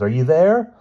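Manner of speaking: loud, high call. A narrator's reading. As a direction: call the name into the dark. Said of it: "he called".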